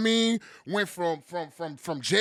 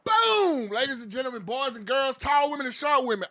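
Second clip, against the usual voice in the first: booming hype announcer; hype man; 5 semitones higher, 3 dB quieter than usual. Acted. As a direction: work the crowd up. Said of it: "the best hype man in this damn country".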